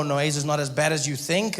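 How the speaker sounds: ordinary talk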